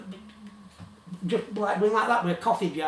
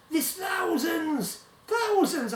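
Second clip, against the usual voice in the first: slow, much higher, drawn out; excited; 9 semitones higher, much slower than usual. Retold or imitated. Colleague gloating about hidden money, drawn-out excited higher voice. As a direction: stretch the word like it's treasure.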